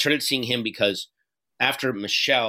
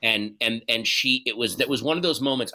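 ordinary talk, nothing marked

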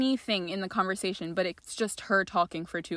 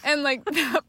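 ordinary talk

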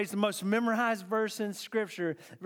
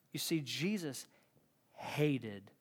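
normal talking voice

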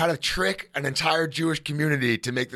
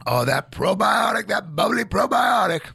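loud rave about the drink; excited; 6 semitones higher, 4 dB louder than usual. Real, not acted. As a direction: gush over it. Raving about kombucha.

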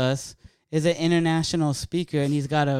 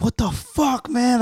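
high-pitched imitated outburst; retold confrontation; 9 semitones higher, slower than usual. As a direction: act out getting in someone's face. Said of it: "I got in his face".

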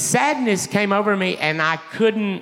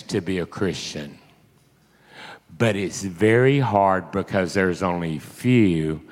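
low, slow, weighty delivery; grave, measured pronouncement; solemn divine message; 8 semitones lower, slower than usual. Retold or imitated. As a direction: quote the Lord low and deliberately. Preacher voicing the Lord's message.

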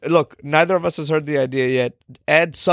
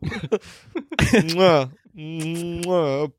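smacking air kisses; kiss sounds. Pretend. Miming kissing the picture.

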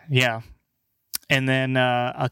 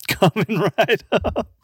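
higher-pitched, through giggles; laughing; 7 semitones higher than usual. Real, not acted. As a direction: crack up while saying it. Cracking up at own bit.

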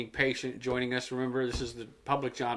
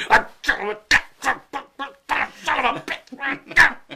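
high, loud, slow sputtering curse; sputtering rage; 10 semitones higher, 6 dB louder, slower than usual. Retold or imitated. Imitating Billy's mad fit, a high, slow sputtering curse. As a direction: splutter it like you're about to explode. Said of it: "and he's all like".

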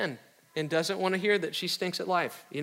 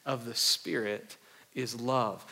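slow, soft, reverent reading of the verse; scripture reading; 7 semitones lower, 5 dB quieter, slower than usual. Acted. Preacher reading scripture to the congregation, slow and reverent. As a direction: lay the verse down gently for the church.

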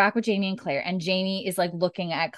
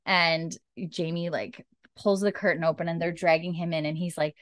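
ordinary talk, nothing marked

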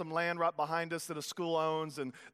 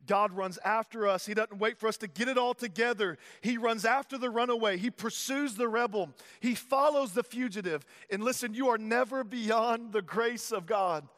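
louder, driving preaching cadence; rising preaching; 4 semitones higher, 3 dB louder than usual. Acted. Pastor proclaiming God's pursuit, rising preaching. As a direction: build the proclamation with force.

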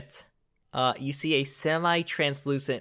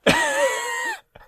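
high, loud laugh; sudden high-pitched cackle; burst of laughter; 5 dB louder than usual. Real, not acted. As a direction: crack up at the insult. Game master laughs at insult.

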